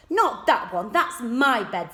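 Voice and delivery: bossy. A narrator's reading. Bossy boy claims bed.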